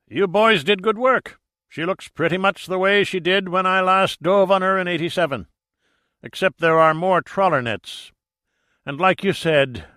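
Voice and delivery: put-on high, loud, hearty old skipper; hearty sea captain. A narrator's reading. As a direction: speak up like a seasoned diving captain.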